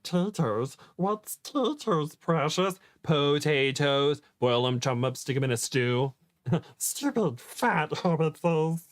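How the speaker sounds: high, slow, scratchy creature voice; Smeagol voice. Acted. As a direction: hiss and whine like Gollum. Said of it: "I like the Smeagol voice".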